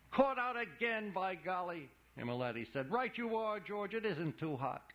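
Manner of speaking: high, bright carnival barker; a huckster playing to the crowd, high; high showman voice. Imolati confessing the trick to George, high showman voice.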